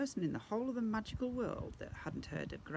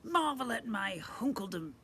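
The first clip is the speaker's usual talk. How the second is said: high, squeaky and louder pompous voice; squeaky goblin prince; 8 semitones higher, 5 dB louder than usual. Acted. Goblin prince greets the family, squeaky and pompous. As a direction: squeak out a smug insult. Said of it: "squeaky voice".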